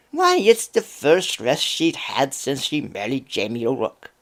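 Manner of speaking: lightly voiced Irish village woman; Irish gossip. Village women gossiping about Eileen in an Irish accent. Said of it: "best attempt at an Irish accent whenever the characters speak".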